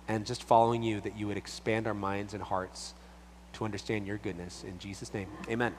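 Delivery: soft, earnest closing prayer, fading away; hushed pastor's prayer. Acted. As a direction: pray gently over the listeners.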